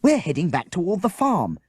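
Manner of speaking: ordinary delivery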